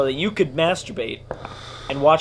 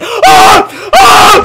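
very high, loud shriek; real fright, loud and high; terrified scream; 16 dB louder than usual. Real, not acted. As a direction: scream as the thing appears. Scare in game.

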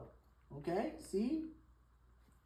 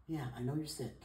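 usual speaking voice

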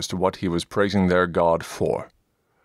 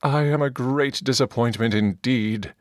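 cried out; 6 semitones higher than usual. Acted. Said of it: "cried out".

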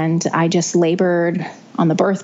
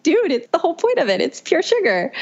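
high incredulous "come on" tone; incredulous retort; 11 semitones higher than usual. Retold or imitated. Mom telling the doctor the drink is pure sugar, incredulous and high. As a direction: scoff at the doctor's logic.

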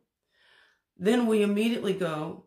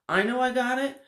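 higher, louder burst of confidence; excited; 8 semitones higher, 4 dB louder than usual. Pretend. Teacher acting a confident walker, excitedly. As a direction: crow it out with gleeful certainty.

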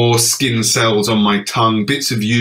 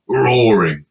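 ordinary delivery

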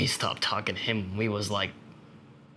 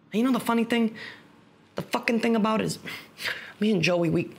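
agitated, high and urgent; worked up; 11 semitones higher than usual. Acted. Explaining the bond with a lost friend, worked up. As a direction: push the voice up high, bursting to explain.

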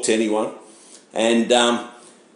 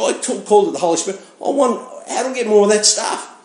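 much higher, fast eager pleading; eager demand; 9 semitones higher than usual. Retold or imitated. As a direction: mimic yourself pushing impatiently for more. Demanding more of the stuff.

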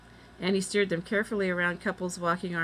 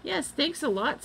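shouted thanks; 9 semitones higher than usual. A narrator's reading. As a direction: call it out in a raised pitch. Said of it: "shouted Jack".

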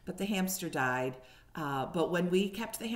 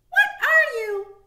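high, loud mouse squeak; a puzzled little squeak; third mouse; 12 dB louder than usual. A narrator's reading. Third mouse asking what Harriet is, high squeak. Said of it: "asked a third".

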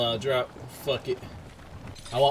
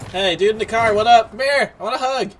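high, loud hail; calling out; 8 semitones higher, 8 dB louder than usual. Real, not acted. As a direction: holler playfully to someone in a car. Calling to a player in a car.

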